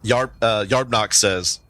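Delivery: ordinary talk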